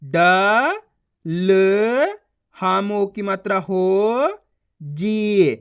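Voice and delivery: ड, ल, हो, जी separated by pauses; syllable sounding out. Shown as a demonstration. Teacher drilling the letters, sounding out. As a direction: give each sound alone and held. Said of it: "उनका उच्चारण करो".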